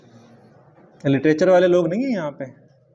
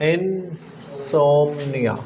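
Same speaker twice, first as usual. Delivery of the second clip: very slow; much slower than usual. Shown as a demonstration.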